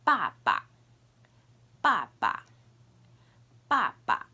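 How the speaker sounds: "ba ba" with a light second syllable; first "ba" forceful, second short; Mandarin baba. Shown as a demonstration. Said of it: "Number three is".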